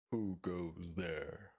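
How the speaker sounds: very slow, booming troll challenge; roaring troll. Acted. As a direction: call out from under the bridge like a troll. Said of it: "roared the troll".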